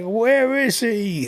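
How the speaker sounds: slow, drawn-out high call; searching neighbor. Retold or imitated. Neighbor calling out for the boy who broke his window, slow and high. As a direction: stretch it out like hunting for the kid.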